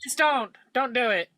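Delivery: high-pitched royal decree; regal voice. Acted. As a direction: finish the decree like a haughty king. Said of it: "command in my regal voice".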